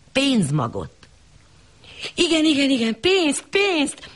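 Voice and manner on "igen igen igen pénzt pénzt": much higher, eager cry of yes; the lady crying out in delight; lady eagerly agreeing to send money, high cry; eager exclamation. Acted. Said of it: "Kiáltott fel az úrasszony".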